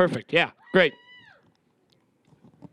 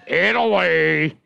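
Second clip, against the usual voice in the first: drawn out; 3 dB louder, much slower than usual. Real, not acted. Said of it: "drawn out answer".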